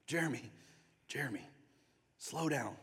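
soft, gentle calming voice; tender. Acted. Jesus gently calling the preacher by name, tender. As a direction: settle them down softly, with pauses. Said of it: "Just speaking tenderly".

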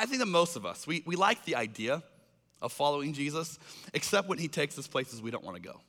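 ordinary talk, nothing marked

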